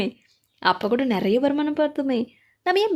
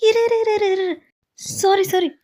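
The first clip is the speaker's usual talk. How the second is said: panicked pleading; 7 semitones higher than usual. Acted. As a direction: beg her to stop, flustered. பதறி நிறுத்த சொல்லுதல். (பதறியபடி உயர்ந்த குரலில் நிறுத்தச் சொல்லுதல்)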